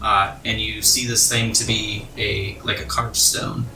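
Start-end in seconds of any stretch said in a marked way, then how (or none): none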